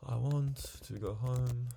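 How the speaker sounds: ordinary delivery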